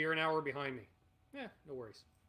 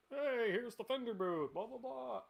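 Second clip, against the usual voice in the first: high, slow presenter patter; mock video presenter; 7 semitones higher than usual. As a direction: parody a booth-tour host, drawn out. Mimics his trade show booth videos.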